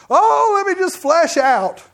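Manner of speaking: playful mock of giving in to feelings; mock indulgent voice. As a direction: ham up a self-indulgent whine.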